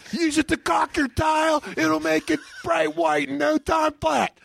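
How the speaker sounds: infomercial pitchman. Pretend. Pitching a cleaning paste in a pretend infomercial, hammed-up salesman voice. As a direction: shout the product pitch up high.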